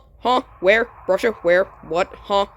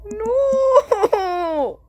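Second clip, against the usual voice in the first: laughing cry; 9 semitones higher than usual. Real, not acted. Laughing despair in game.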